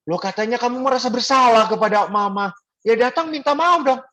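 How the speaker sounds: high-pitched mock protest; desakan tiruan. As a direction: urge the child, pitched well up. Priest retold urging child to apologize. (mendesak anak dengan nada tinggi)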